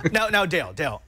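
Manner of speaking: ordinary delivery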